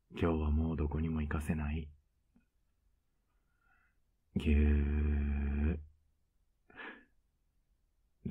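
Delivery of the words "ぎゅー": one long held hug sound; drawn-out squeeze. Acted. Boyfriend hugging his shy partner, drawn-out squeeze. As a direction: stretch the word out long, like a squeeze.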